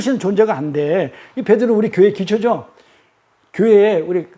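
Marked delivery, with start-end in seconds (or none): none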